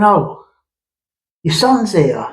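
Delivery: ordinary delivery, nothing marked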